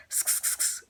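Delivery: soft unvoiced sk clicks, a light imitation; mock sk sound. Mom mimicking her son's teasing, unvoiced sk clicks. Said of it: "sick of hearing him go".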